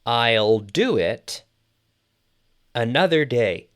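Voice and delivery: very slow, with a pause mid-sentence; slow model sentence. Shown as a demonstration. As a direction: model it slowly for English learners. Teacher models the translation slowly.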